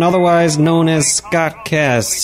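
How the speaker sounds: high-pitched show-opening announcement; podcast intro announcer. Acted. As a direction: rattle off the grand title, then stretch out the name.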